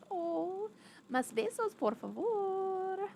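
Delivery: high, slow sing-song Spanish plea for kisses; cooing pet talk. Real, not acted. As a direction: coo it sweetly at the pet.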